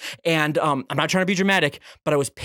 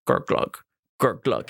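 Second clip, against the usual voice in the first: imitated gurgling flush; 8 semitones lower, slower than usual. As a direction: sound like a toilet barely draining. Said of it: "and it slowly goes down".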